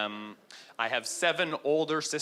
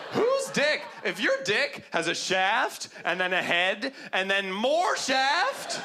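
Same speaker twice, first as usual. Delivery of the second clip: rising, indignant and laughing; incredulous rant; 8 semitones higher than usual. Retold or imitated. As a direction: tear into him, half laughing. Angry rebuttal to Toronto hater.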